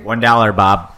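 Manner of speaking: loud mock contestant bid; game show bid. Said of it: "Price Right rules".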